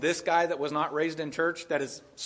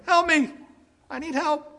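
loud, high desperate plea; a mother begging Jesus, crying out for help; 11 semitones higher, 6 dB louder than usual. Acted. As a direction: cry out to the Lord for rescue. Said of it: "She cries out".

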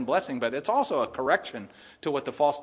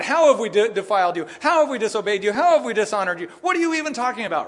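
loud, high, protesting Israelites; indignant shout at God; 6 semitones higher, 7 dB louder than usual. Acted. Israelites shouting at God in protest, loud and high. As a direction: shout it back at God, offended. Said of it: "they're shouting at God".

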